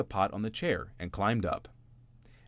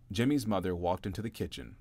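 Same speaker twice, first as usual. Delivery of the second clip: ordinary delivery, nothing marked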